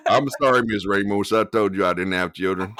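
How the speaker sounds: put-on Southern US drawl, firm; hammed-up Southern character voice. Shown as a demonstration. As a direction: protest in a Southern accent. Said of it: "like, my Southern drawl".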